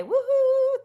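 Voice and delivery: high excited woohoo; cheering. Real, not acted. Cheering goodbye to tech host.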